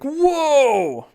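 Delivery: very high, loud amazed whoa; awestruck whoa. Acted. Reader voicing the querent's awe, shouted whoa. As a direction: gasp it out in wonder. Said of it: "And you're like".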